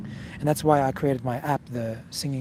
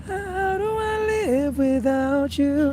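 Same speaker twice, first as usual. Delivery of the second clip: sung much higher, slow and louder; high chest voice; 12 semitones higher, 3 dB louder, much slower than usual. Shown as a demonstration. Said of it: "In your high chest".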